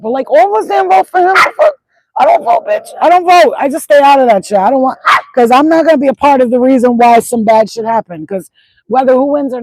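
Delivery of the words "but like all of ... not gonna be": heated rant. Real, not acted. Ranting about not voting.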